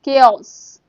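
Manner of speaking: "केओस" said KAY-oss, called out; "chaos" pronounced kay-oss, loud; loud pronunciation demo. Shown as a demonstration. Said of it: "इसे हम बोलते हैं केओस".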